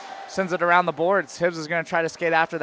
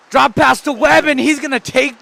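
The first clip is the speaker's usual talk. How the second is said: excited call; 7 semitones higher, 8 dB louder than usual. Real, not acted. Calling a rush.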